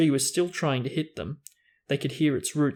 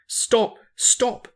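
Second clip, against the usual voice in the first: much higher, louder repeated yell; 10 semitones higher, 5 dB louder than usual. Acted. Ron beating the dashboard as they plummet, yelling. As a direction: bark it at the plunging car. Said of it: "he yelled".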